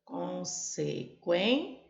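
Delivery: consequência said slowly, syllables pulled apart. Shown as a demonstration. Teacher showing the syllable split, slow. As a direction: drag out consequência beat by beat. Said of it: "Como eu separo a sílaba".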